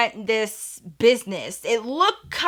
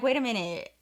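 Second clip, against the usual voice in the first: hushed realisation; 10 dB quieter than usual. Retold or imitated. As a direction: say it under the breath. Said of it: "they were like".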